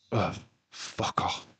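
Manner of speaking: a slow, breathy "ugh, fuck off" under the breath; disgusted whisper. Real, not acted. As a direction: whisper it slowly, fed up. Disgusted aside about moaning fans.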